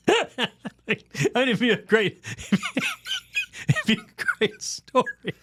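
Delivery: high, helpless laughter through the words; real laughing. Real, not acted. Storyteller cracks up at his own absurd death, laughing hard. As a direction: squeeze the words out while cracking up.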